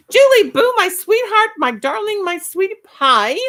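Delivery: high, loud, gushing endearments; a rush of high loud pet names to a beloved viewer; gushing squeal. Real, not acted. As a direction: squeal with delight at a dear friend. Gushing greeting to favourite viewer.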